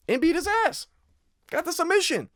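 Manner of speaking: hyped-up excitement. Real, not acted. To betting viewers, praising Vidal's win with high excitement. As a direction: sell the submission win with glee. Hyping up his pick's win.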